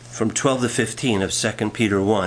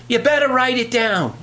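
high-pitched mock nagging wife; higher, acted wifely warning; 11 semitones higher than usual. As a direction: warn him in a raised, wifely pitch. Wife nags husband in joke.

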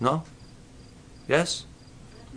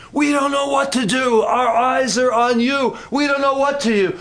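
loud cry; 9 semitones higher, 4 dB louder than usual. Shown as a demonstration. Preacher crying out helplessness to the Lord, loud. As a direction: call it out to heaven. Louder retake after whispered version.

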